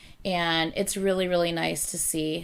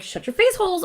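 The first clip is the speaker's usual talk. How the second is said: mock retort; 11 semitones higher, 4 dB louder, faster than usual.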